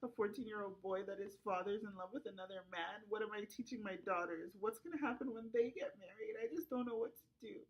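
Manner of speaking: usual speaking voice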